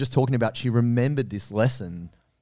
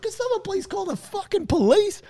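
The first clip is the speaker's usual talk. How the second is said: very high mock-prim plea; hammed-up prim old lady; fussy neighbor. Old neighbor demanding police, mock-prim squeal. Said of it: "She's like".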